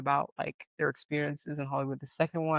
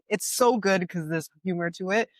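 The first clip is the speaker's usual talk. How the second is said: ordinary talk, nothing marked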